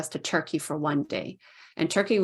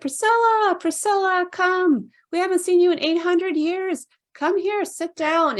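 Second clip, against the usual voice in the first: very high, sing-song calling of her name; calling spirit voice; 14 semitones higher, 3 dB louder than usual. Retold or imitated. Temples welcoming her back home in a very high beckoning voice.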